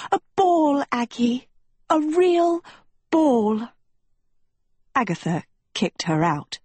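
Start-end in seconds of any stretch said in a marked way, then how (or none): 0.1-3.7 high breathless swoon over a ball; gush, wide-eyed with longing; dreamy excitement. A narrator's reading.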